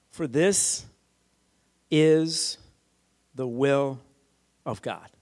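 word by word, long pauses; deliberate emphasis. Real, not acted. Preacher reading Paul's verse to the congregation, slowly and deliberately. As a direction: let each phrase land separately, weighty.

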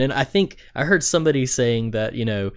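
ordinary delivery

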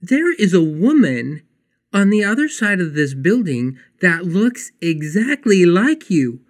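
amazed, leaning on every word; shocked. Retold or imitated. Telling Claudia about her lookalike, astonished. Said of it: "I'm shocked".

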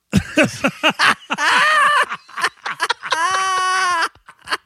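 long, high-pitched helpless laughter; wheezing high laugh; real laughing fit. Real, not acted. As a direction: crack up completely at your own story. Cracks up at the fake name.

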